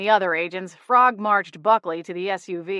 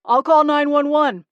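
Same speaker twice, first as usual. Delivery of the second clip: urgent; 7 semitones higher, 7 dB louder than usual. A narrator's reading. Bystander calls out in alarm.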